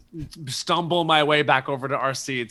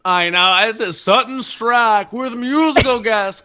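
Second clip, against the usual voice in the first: high, loud drawn-out host introduction; mock show announcer; 8 semitones higher, 7 dB louder, slower than usual. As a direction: announce the musical guest with big fanfare.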